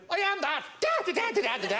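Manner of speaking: very high mock-Irish leprechaun babble; squeaky put-on Irish voice; Irish gibberish. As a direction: pitch it way up, comic Irish gibberish. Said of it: "sort of Irish gibberish".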